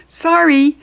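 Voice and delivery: a quick, bright refusal; apologetic worker. Acted.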